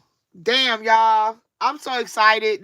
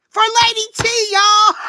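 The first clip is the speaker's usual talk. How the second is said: high, loud cry of triumph; loud high hype call; triumphant shout-out; 13 semitones higher, 4 dB louder than usual. Real, not acted.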